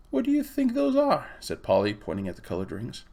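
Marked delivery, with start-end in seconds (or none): 0.1-1.3 put-on high, wondering girl's voice; a young girl asking, intrigued; curious girl. A narrator's reading. Polly points at the colored rings, high curious girl's voice.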